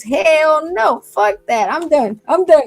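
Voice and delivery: loud, high mock refusal; hammed-up shouting no way; retold outburst. Friend refusing the kid, mock yelled. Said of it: "he goes".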